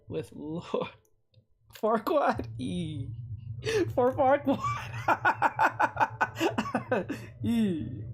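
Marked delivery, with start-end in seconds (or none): 1.8-4.6 amused surprise. Real, not acted. Viewer suggests drawing Lord Farquaad. 4.6-7.4 high, rippling giggle fit; high burst of laughter. Real, not acted. Streamer laughs at chat's Lord Farquaad idea, high giggle fit.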